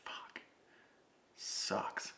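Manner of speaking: whispered, deflated curse; defeated whisper. Retold or imitated. Driver after the team scolds him, whispered defeated curse. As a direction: breathe it out in defeat. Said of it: "And I'm just like".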